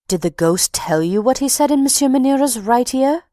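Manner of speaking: high, lightly voiced mock-serious question; mock gravity. Manager teases the box-keeper, mock grave. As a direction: ask with teasing false solemnity. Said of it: "with a gravity which he thought exceedingly humorous".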